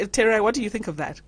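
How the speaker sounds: usual speaking voice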